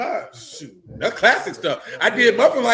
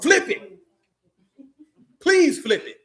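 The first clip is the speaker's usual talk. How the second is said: high, punchy dare; egging someone on, pitched up; daring challenge; 8 semitones higher, slower than usual. Retold or imitated. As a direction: dare them with a raised, pointed plea. Acting out daring a chair flipper.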